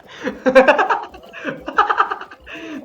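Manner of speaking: high loud laughing fit; burst of laughter. Real, not acted. Gamer cracking up at a player's fall, laughing hard. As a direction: crack up at the screen. Something funny happens in game.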